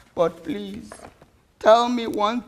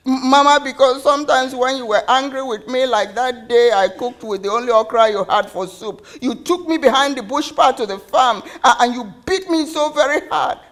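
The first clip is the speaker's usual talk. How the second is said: put-on loud, higher, stammering child's accusation; distressed outpouring; 4 semitones higher, 6 dB louder than usual. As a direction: pour it out in a hurt, insistent rush. Child pours out hurt, stammering.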